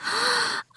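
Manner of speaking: sharp, high intake of breath; startled gasp. A narrator's reading. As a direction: gasp as if caught out. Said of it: "they gasped".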